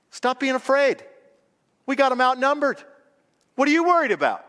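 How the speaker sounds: high-pitched, incredulous pep talk; emphatic reassurance. Acted. Prophet to his scared servant, emphatically reassuring. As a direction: brush off the fear, pitched well up. Rallying the frightened servant, acted.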